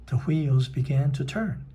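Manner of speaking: ordinary delivery, nothing marked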